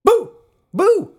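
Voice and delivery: loud, high booing. Pretend. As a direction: boo the artist like a heckler. Booing the album's artist.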